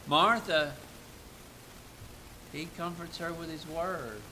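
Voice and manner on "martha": usual speaking voice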